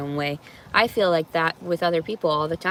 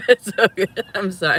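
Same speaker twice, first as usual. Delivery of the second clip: laughing; 2 semitones higher, 7 dB louder than usual. Real, not acted. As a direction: say it while cracking up. Laughing at the joke.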